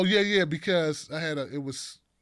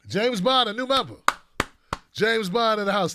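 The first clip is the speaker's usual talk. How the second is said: ordinary talk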